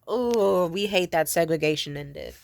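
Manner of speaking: loud, hammed-up snooty staff voice; mock the snobby waiter. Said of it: "it's like".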